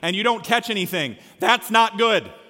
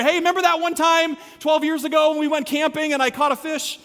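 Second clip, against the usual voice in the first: higher, louder buddy reminiscing; eager, raised storytelling to pals; excited inside joke; 8 semitones higher, 4 dB louder than usual. Acted. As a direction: tell it like an old friend hyped about a fish story. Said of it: "Jesus is like when you get together".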